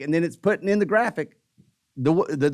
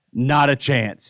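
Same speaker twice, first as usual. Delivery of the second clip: low, slow, emphatic denial; deadpan dismissal; 5 semitones lower, much slower than usual. Retold or imitated. Friend answering whether Dale knows something, deadpan. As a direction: stretch it out low with total certainty.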